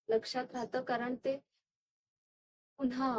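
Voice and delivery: hushed, halting recall with long pauses; haunted recollection. Acted. Woman recalling her recurring nightmare, quiet and halting.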